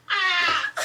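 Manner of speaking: short high, loud yelp; hammed-up cry of frustration; frustrated mock scream. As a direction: let out a sudden exasperated "ah". Acts out frustration at his absence.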